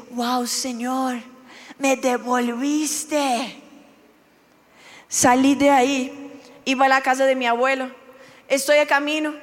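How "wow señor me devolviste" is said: soft and very slow, Señor hushed in wonder; awed, hushed thanks. Retold or imitated. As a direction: breathe it out in quiet awe, drawn out. Awed realisation of God's return. (asombro susurrado, muy lento)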